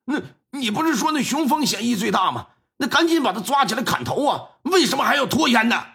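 an angry father pressing for an execution; indignant. Acted. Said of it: "显得就挺气愤：". (气愤地质问)

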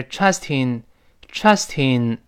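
trusting said slowly as one word; linked trust in. Shown as a demonstration. Teacher shows trust in linking. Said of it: "连读变成trusting".

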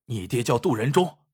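usual speaking voice

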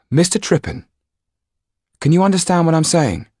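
put-on a brave girl calling out; girl Jan, urgent. A narrator's reading. Jan calls to the captive actor, urgently.

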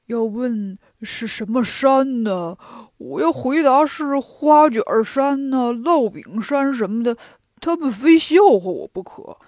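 slow, deliberate pig character thinking aloud; scheming Pigsie. A narrator's reading. Pigsie practising his false report before three stones, scheming. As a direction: rehearse a lie slowly, pleased with yourself.

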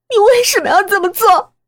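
high, loud demand for a reason; anguished outcry. Acted. Daughter asks mother why she did it, in an anguished cry. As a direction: cry out the question, pitched high.

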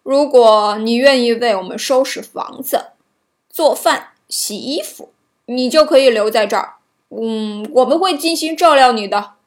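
a kindly dwarf offering help. A narrator's reading. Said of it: "小矮人们听了之后非常同情她的遭遇".

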